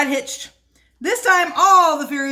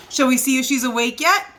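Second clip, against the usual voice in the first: plain reading voice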